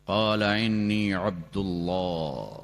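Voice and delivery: slow Quranic recitation; resounding proclamation. Acted. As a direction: let the verse ring out slowly. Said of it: "زور سے عیسیٰ علیہ السلام شروع ہوئے".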